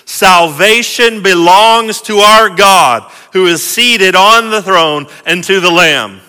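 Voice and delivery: loud, ringing proclamation; loud cry. Acted. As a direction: cry it out to a vast crowd. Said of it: "cried out in a loud voice".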